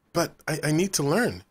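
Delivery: a stammering plea to be let along; complaining. A narrator's reading. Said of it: "Daniel complained".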